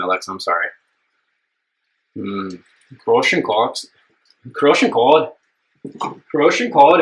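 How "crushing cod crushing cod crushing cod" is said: half-hearted Irish accent attempt; badly faked Irish accent, higher on each try; terrible Irish accent. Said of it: "embarrass myself with an Irish accent".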